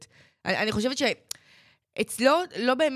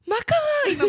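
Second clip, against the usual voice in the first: high put-on worried voice; mock worried friend; 5 dB louder, much slower than usual. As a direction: imitate her alarmed question. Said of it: "עושה לי". (חיקוי גבוה של שאלה מודאגת)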